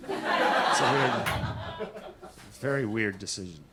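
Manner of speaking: drawn-out, low and quiet; lightly voiced therapist, slowed down. Therapist quote, slow low.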